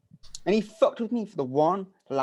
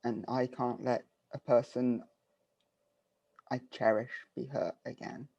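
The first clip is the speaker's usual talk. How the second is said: soft, hurting confession; quiet plea; 5 semitones lower, 10 dB quieter than usual. Acted. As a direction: say it low and wounded. Character quiet emotional plea.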